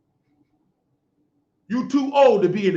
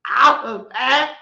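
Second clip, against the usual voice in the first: slow mock, rising at the end; mocking her talk; 3 semitones higher, 3 dB louder, much slower than usual. Ridiculing how the woman talks, slow mock voice. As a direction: draw out her words to ridicule them. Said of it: "The way you talk".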